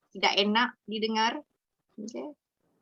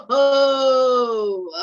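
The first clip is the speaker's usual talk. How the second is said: wicked witch cackle; 3 semitones higher, 9 dB louder than usual. Shown as a demonstration. Teacher demoing a witch voice for children. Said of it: "you can be the wicked witch".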